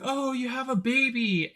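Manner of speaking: high, mock-delighted coo about the baby; lightly voiced gushing surprise. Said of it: "he's like".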